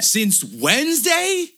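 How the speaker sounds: high, loud, drawn-out incredulous question; acted outrage. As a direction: stretch it out in hammed-up fury. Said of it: "I'm fucking pissed".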